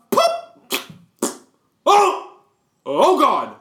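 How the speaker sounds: high, loud startled yelps; shocked at the squish; startled outburst. Retold or imitated. Steps on a slug.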